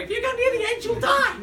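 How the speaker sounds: very high, loud mock mum; hammed-up shrill motherly warning at the top of the range; alarmed mother. Mother warning grown child at the edge, shrieked mockingly. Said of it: "and my mother's going".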